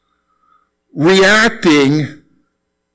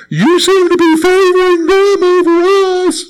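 much higher, mock-aggrieved whine; whiny family member. Relative accusing family of favoritism, high whine. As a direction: complain like a jealous sibling.

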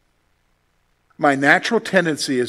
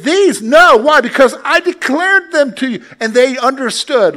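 emphatic preaching; 8 semitones higher, 7 dB louder than usual. Real, not acted.

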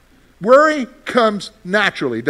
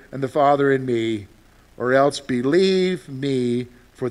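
scripture reading; 4 semitones lower, 3 dB quieter than usual. Acted. Pastor reading John fourteen to the congregation, solemn and measured. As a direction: read the words of Jesus with gravity. Reads scripture passage aloud.

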